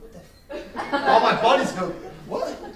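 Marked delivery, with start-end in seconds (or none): 0.0-1.1 stunned disbelief. Retold or imitated. Shocked by the offer. 2.3-2.8 shocked buddies. Retold or imitated.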